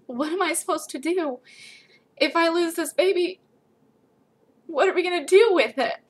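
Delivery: high, choked-up, desperate questions; tearful pleading. Retold or imitated. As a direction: plead through rising tears. Tearful question to her husband.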